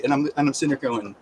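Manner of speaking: ordinary talk, nothing marked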